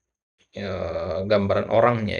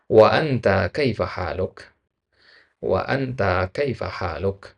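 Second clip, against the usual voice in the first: slow model reading; slower than usual. Shown as a demonstration. Teacher models reply slowly.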